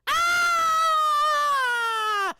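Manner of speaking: high, loud panicked scream; mock scream. Pretend anchor screaming between segments, high loud scream. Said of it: "running back and forth screaming".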